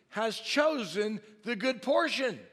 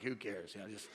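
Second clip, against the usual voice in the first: laid-back shrug; 10 semitones lower, 6 dB quieter than usual. Retold or imitated. Relaxed older couple not caring.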